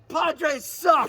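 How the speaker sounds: loud, high jeering yell; heckler's shout. Retold or imitated. A fan heckles at the mention of San Diego, shouting. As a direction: yell it at the field like a rival fan. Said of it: "somebody goes".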